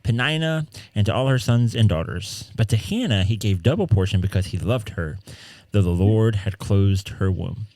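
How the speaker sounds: measured, even Bible reading voice; scripture reading. Acted. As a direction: read the passage aloud with care. Reads scripture passage aloud.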